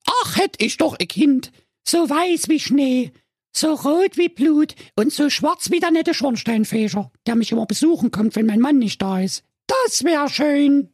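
very high, dreamy wishing voice; the pediatrician wishing. A narrator's reading. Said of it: "da dachte sie bei sich".

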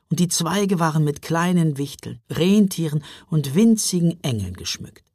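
ordinary delivery, nothing marked

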